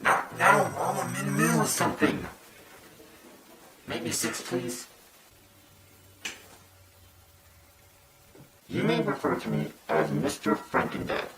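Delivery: husky masked anonymous voice; disguised deep voice. Pretend. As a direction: hide your identity with your voice. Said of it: "I G-majored my voice".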